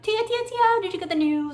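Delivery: high, loud Southern belle squeal calling Tia; a gushing Southern Lottie calling her friend; southern accent. Retold or imitated. Sister recreating the Lottie scene in a squealing southern accent. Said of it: "they all have their southern accent".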